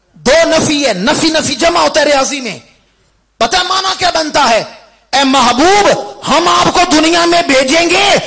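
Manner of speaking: high, loud oratory rising to a shout; full-force preaching. Acted. Preacher proclaiming God's mercy to the world, full-force shouted oratory. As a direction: thunder it out to the congregation.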